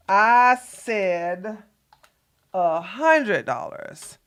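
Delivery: each word dragged out slowly; slow, spelled-out repeat. Shown as a demonstration. Streamer spelling out the price for a chatter, slowly. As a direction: say it slowly as if to a child. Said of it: "so you know what a hundred dollars look like".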